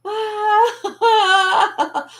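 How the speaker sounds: long, high, loud wailing cry; hammed-up wailing shriek; mock scream. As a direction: imitate a prankster's fake agony. Imitates the janitor's trick scream.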